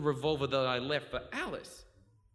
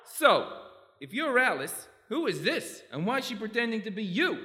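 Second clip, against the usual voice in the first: bewildered; 8 semitones higher, 4 dB louder than usual. Acted. Captain bewildered by the reveal.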